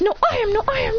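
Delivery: put-on high squabbling girl voice; bickering. Ino fighting Sakura for the seat, high bickering.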